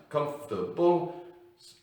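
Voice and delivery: "Comfortable", "beautiful", "sensible" slowly drawn out in separate syllables. Shown as a demonstration. Teacher showing learners three-syllable words, slowly syllable by syllable. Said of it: "These words have three syllables".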